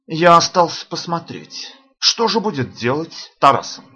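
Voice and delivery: ordinary delivery, nothing marked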